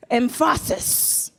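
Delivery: "Emphasis" drawn out slowly, syllable by syllable; slow, showy stress on "Emphasis"; exaggerated emphasis. Shown as a demonstration. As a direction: stretch "Emphasis" with mock importance. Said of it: "you gotta put the emphasis".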